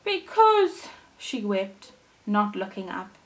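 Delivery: gentle storybook reading voice; storyteller reading a fairy tale to kids; story narration. A narrator's reading. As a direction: read it like a bedtime story for children. Reads Thumbelina story aloud.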